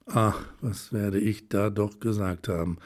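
plain reading voice

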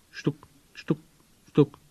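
short knock syllables; tapping noise made by voice; knocking imitation. A narrator's reading. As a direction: imitate three raps on wood. Narrator imitates the secret knocking.

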